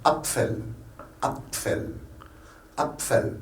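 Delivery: "Apfel" with both P and F sounded; every letter sounded. Shown as a demonstration. Teacher modelling German for French learners, every letter sounded. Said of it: "toutes les lettres se prononcent".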